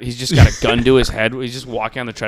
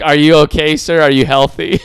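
mock concerned subjects; 8 dB louder than usual. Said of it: "And they're like".

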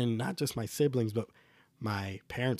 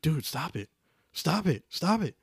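escalating stop it; 5 semitones higher than usual. Retold or imitated. Warning the little brother to stop, building to a yell. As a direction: start firm, then climb into a shout.

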